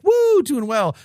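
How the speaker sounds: high, loud mock whoop; mock cheer. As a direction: yell Woo way up high, then settle. Imitating a fan cheering.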